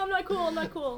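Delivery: squeaky self-mocking whine; high mock whine. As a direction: pitch up, whine it jokingly. Playful self-mocking repeat.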